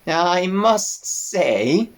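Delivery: put-on slow, high bleating old sheep; old ewe voice. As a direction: drawl it like a tired old ewe.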